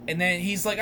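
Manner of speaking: usual speaking voice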